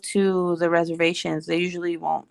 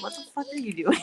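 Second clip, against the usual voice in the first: higher, laughing through the words; laughing disbelief; 4 semitones higher, 5 dB quieter, faster than usual. Retold or imitated. Wife reacting to her husband's rain dance, laughing in disbelief. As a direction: crack up while quoting your own disbelief. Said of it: "it makes me laugh because I'm like".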